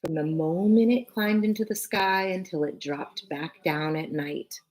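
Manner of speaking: ordinary delivery, nothing marked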